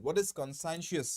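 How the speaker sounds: ordinary talk, nothing marked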